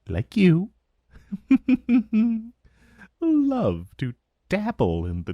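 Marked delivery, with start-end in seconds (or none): 1.3-2.5 teasing chuckle. Acted. Dragon teases mage's dark arts.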